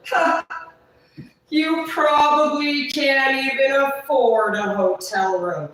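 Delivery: high, loud, slow taunt; imitating a loud, drawn-out sneer; loud mocking. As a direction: scoff and stretch out the jeer. Retelling his mocking reply.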